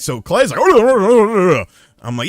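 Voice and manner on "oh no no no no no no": high, loud incomprehensible babble; garbled Clay impression. Friend reacting to the near crash, loud garbled babble. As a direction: imitate a frantic, unintelligible rant. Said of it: "you couldn't understand him half the time".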